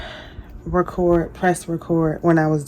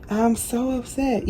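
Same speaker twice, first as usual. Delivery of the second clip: higher, softer whine of upset; softer pouty lament; whiny upset; 4 semitones higher, 6 dB quieter than usual. Real, not acted. Sorry she forgot to record.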